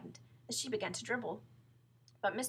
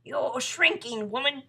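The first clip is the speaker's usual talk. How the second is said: loud, slow, raised pronouncement; taunting husband; 4 semitones higher, 13 dB louder, slower than usual. Acted. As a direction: drive it home to her. Husband taunts wife in story.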